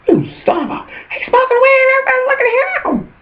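very high falsetto, rushing at the end; tattling squeal. Pretend. Calling out the tempter to the crowd, very high squeal. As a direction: shriek it out so everyone turns to look.

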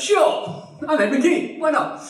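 very high, breezy mock swagger; hammed-up tipsy star agreeing to anything; drunk grandee. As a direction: toss it off like a rich drunk. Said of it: "And he goes".

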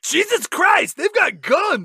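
high, loud freaked-out yell; panicked shout. Retold or imitated. Milo voiced in recap.

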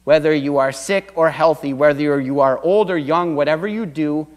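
ordinary talk, nothing marked

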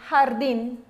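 "jardim" com J raspado na garganta; Spanish jardín. Shown as a demonstration. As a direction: diga "jardim" como em espanhol, J raspado. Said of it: "você vai repetir pra mim aqui". ("jardim" com J espanhol raspado)

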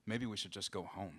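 low, soft husband's grumble; muttered. A narrator's reading. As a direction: mutter it so the back seat can't hear. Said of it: "her husband muttered".

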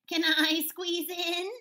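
high, loud, slow goat question; nanny goat voice. Acted. Nanny Goat asks to squeeze in, high goat voice. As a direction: plead loudly like an eager cartoon goat. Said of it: "asked Nanny Goat".

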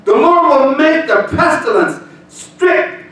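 high, loud, slow with shouted words; shouted preaching. Acted. Pastor shouting the curses over the church. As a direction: hurl the curses at the congregation.

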